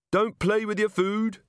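high, slow sing-out; crowd heckler. A narrator's reading. Voice from the pub crowd to Angua, heckling. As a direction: call it out high and drawn out.

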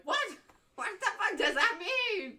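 half-laughing shout of disbelief; laughing yell. Retold or imitated. Retelling yelling at her little brother, laughing and loud.

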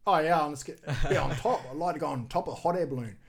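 eager retold boast; mock excited. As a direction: pitch it like a kid who just had a brilliant idea. Retells his eager kid plan.

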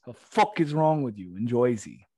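indignant, put-on New Jersey accent; hammed-up Jersey outrage; mock Jersey accent. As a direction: complain like a caricature Jerseyite. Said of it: "the Joyzy accent".

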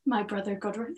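put-on a weak try at Bryn's Welsh accent. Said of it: "I can't do that accent".

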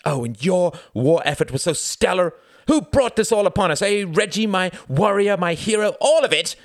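very high, loud, taunting outburst; rising ire. A narrator's reading. Wendy mocking Reginald's war effort in a quarrel, irate. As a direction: hurl mock praise at him furiously. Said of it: "Wendy's ire rose instantly".

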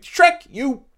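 high, scornful Fiona voice; mock Fiona. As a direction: storm out on Shrek. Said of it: "Fiona's like".

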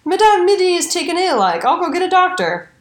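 high-pitched, breezy imitation of a calm man; mock-calm high voice; feigned calm. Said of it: "very calmly said".

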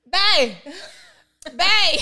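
a little daughter calling to her dad, high and loud; toddler calling bae. Retold or imitated. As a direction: call out like a tiny kid. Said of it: "Zoe be like".

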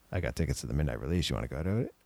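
low, quick, casual offer; older brother's invitation. Retold or imitated. Brother phones kid about midnight tickets, low casual invitation. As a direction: drop your voice like a cool big brother.

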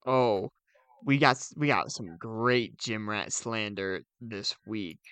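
usual speaking voice

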